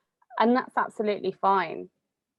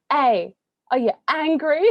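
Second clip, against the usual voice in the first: higher, louder mock mum questioning; imitating mum; 7 semitones higher, 6 dB louder than usual. Mum quizzing her snappy daughter, higher and louder mock voice. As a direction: lightly play the mum probing her moody daughter. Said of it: "And then she goes".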